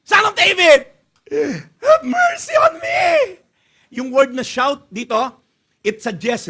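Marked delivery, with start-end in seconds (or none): 0.0-0.9 loud, high-pitched yell; high, insistent shout over objections; shouting even more. Acted. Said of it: "Lalo siya sumigaw". 1.8-3.4 very high, drawn-out cry; high pleading scream; desperate shouting. Acted. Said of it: "Lalo siya sumigaw".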